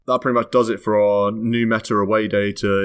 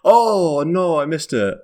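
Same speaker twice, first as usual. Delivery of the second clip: high, loud cry of dismay; dismayed realisation; 9 semitones higher, 4 dB louder, slower than usual. Real, not acted. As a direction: let the "oh no" leap up high. Realises he forgot the shout-out.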